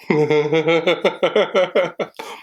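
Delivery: high, incredulous laugh; burst of laughter. Real, not acted. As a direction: laugh out in disbelief. Laughs at seeing the woman.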